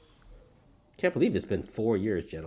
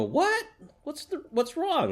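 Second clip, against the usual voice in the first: put-on much higher, squeaky astonished old-timer voices; surprised old men; slower than usual. Old men in the joke hear the third guy, high surprised. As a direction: jump up in pitch with disbelief.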